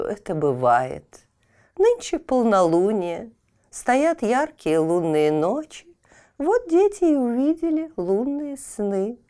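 put-on slow, high old crone; old woman voice. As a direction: soothe like a wise old woman.

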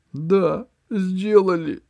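put-on high, childlike agreement; high childish echo; dazed old man. A narrator's reading. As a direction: echo her words like a frightened child. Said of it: "согласился Меркурий".

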